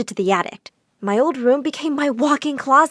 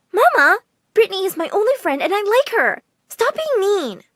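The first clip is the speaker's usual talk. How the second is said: put-on high-pitched upset child's plea; hurt, high whine of protest; pleading; 9 semitones higher than usual. A narrator's reading. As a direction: stand up for a friend, voice high. Daughter pleading for her friend.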